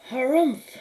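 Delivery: high, loud disgruntled harumph; grumpy harumph. Acted. Befana shutting out the star light, loud grumpy harumph. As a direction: huff it out like a cross old woman. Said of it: "said old Befana".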